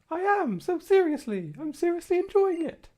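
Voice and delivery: squeaky cheerful reply. Pretend. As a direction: answer like a giddy cartoon kid. Voicing the other side of the Gladion exchange.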